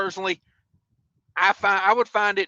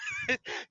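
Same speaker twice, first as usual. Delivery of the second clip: high laugh; 10 dB quieter than usual. Real, not acted. Laughing at the memory.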